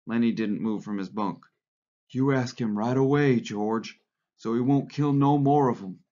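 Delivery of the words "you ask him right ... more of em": mildly excited, urging plea; eager pleading. A narrator's reading. Lenny urging George to ask for a pup, eager. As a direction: press the request eagerly, lifting at the end.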